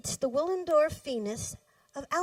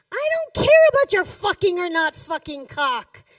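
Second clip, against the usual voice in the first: loud, higher, spitting the words; furious outburst; 7 semitones higher, 12 dB louder than usual. A narrator's reading. Woman flings her anger at a lover's obsession, loud and furious. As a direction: fling it at him in full fury.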